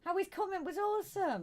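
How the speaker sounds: high-pitched insistent protest; indignant protest. Real, not acted. Host defending herself to co-host over a comment, protesting. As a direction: protest your innocence, pitched right up. Carries on protesting after interjection.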